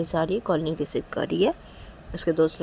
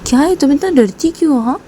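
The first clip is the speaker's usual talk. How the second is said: defiant reassurance; 3 semitones higher, 14 dB louder than usual. Acted. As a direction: brush off her fear boldly. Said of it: "तो तभी काजल रेशमा को देखी".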